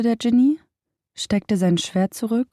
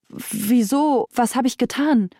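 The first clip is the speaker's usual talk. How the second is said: higher, bewildered and scared; frightened merchant; 5 semitones higher than usual. Acted. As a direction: ask why in startled fear.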